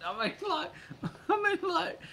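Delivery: high, squeaky words through laughter; helpless giggling, pitch shooting up; laughing too hard. Real, not acted. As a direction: try to talk while cracking up. Joking about bodysuit, cracks up.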